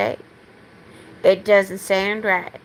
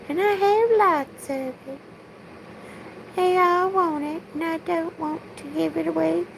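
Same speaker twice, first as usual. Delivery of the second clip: much higher put-on Southern belle, held throughout; 8 semitones higher, 4 dB quieter than usual. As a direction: keep the high-pitched belle voice going through the rant. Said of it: "I still have to speak like a Southern belle".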